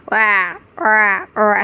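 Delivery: a quick cawing wah wah wah like a crow; imitating a crow's caw; crow caw imitation. Shown as a demonstration. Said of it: "crow sound".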